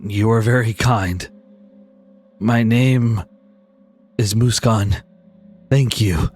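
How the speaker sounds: slow, low-voiced gentle beast; grateful buffalo. Buffalo introducing himself to the one feeding him, low and grateful. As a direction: speak low with halting gratitude.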